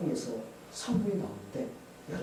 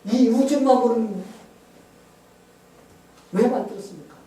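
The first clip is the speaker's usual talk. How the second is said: questioning God; 9 semitones higher, 12 dB louder than usual. Retold or imitated. Retelling the scientist demanding an answer from God, questioning cry. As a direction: call the big question up to heaven. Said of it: "이제 이렇게 물었어요".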